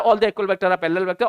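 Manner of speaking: rattled off in one run without stressing any word; deliberately fast. Shown as a demonstration. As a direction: hurry through the sentence, words run together. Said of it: "मैं जानबूझ के fast बोल रहा हूं". (जानबूझकर जल्दी-जल्दी, बिना रुके)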